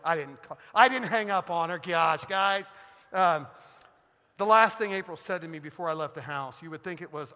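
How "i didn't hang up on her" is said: usual speaking voice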